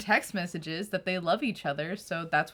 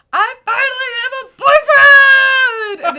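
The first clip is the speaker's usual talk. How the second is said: all-caps shout; 18 dB louder, faster than usual. Retold or imitated. Reading her diary's good news, all-caps excitement. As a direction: shout it like exclamation points with hearts. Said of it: "it's in all caps".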